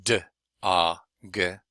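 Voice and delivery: d, a, g separated as "duh, ah, guh"; d, a, g with a short gap between the sounds; individual sounds. Shown as a demonstration. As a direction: sound out d, a, g one by one. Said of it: "individual sounds like".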